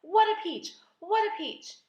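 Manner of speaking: high, loud marvelling cry; excited shout. A narrator's reading. Aunt marvels at the huge peach, excited shout. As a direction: exclaim it high and gleefully. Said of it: "Aunt Spiker shouted".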